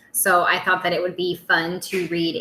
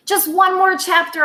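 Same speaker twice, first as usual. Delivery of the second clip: put-on a child pleading, pitched up high; begging child; 12 semitones higher, 6 dB louder than usual. Child begging mom for one more chapter, pleading. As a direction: plead for just a bit more. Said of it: "I begged my mom".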